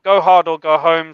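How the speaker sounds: loud declaration, up a little; mock battle cry. Telling cohost about printing the signal box, mock-heroic declaration. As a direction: belt it like a rallying cry.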